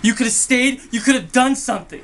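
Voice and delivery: loud, high-pitched angry accusation; anguished outburst. Acted. Sibling blames Charlie for leaving dying parents, anguished shouting.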